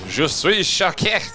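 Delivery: a hint of hammed-up French shock; lightly voiced French gasp of shock; french chef. Mock French reaction to video.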